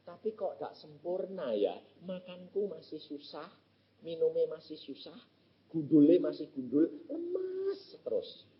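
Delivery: weak complaint. Retold or imitated. Thin young man complaining he is still weak, soft and tired. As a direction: sound drained and weak.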